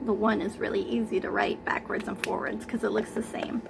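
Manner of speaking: hurried unvoiced aside, fast; quick whisper. Teacher whispering a quick note while writing the number.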